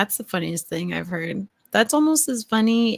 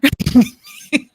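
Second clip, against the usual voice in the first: loud high snorting laugh; real snort laugh; 12 dB louder than usual. Real, not acted. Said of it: "I just snorted".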